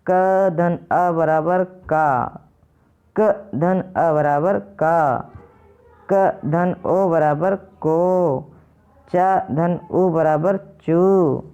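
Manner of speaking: slow, every sound laid out separately; slow syllable building. Shown as a demonstration. Teacher to students, joining consonant and vowel: slow, spelled out. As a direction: spell it out slowly for the class. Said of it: "जैसे".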